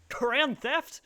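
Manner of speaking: high, loud scornful scoff at "Grand theft?"; mocking scoff. Mocking the clip's tough guys, a loud high scoffing "Grand theft?". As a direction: sneer "Grand theft?" up high like it's nothing. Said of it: "they see that it's like".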